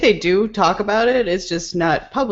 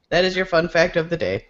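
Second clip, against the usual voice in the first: ordinary delivery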